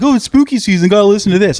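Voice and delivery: a teasing sing-song spooky-fan impression; mocking dorks. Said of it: "all those dorks that are like".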